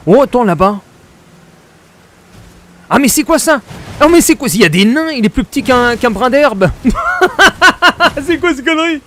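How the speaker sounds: high, loud disbelief; incredulous shouting. Real, not acted. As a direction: gasp it out in amazement. Tiny dwarves found.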